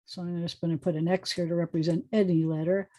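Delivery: ordinary talk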